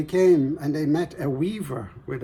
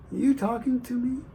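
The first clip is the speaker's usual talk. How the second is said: high, wondering farmer's voice; puzzled farmer; 11 semitones higher than usual. Acted. Farmer asking his cow, high and baffled.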